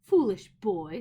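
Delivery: witch voice. A narrator's reading. Witch scolding the fleeing boy, scornful. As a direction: sneer it at the boy.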